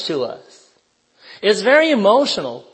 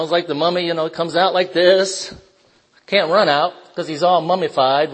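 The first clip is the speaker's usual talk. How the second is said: ordinary delivery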